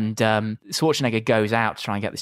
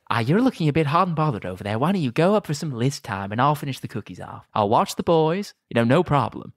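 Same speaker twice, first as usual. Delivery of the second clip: smarmy Ted; 5 semitones higher than usual. Retold or imitated.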